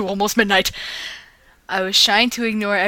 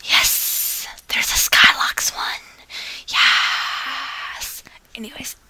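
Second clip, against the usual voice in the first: whisper; 5 semitones higher, much slower than usual. Real, not acted. Whispered asides between stories.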